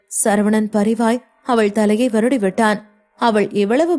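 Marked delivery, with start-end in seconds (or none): none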